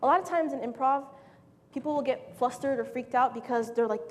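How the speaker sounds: ordinary talk, nothing marked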